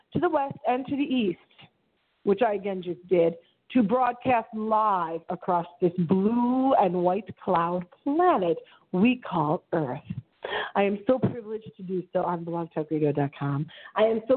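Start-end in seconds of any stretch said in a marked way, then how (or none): 3.7-10.0 slow grand phrasing. Acted.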